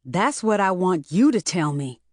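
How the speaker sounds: ordinary delivery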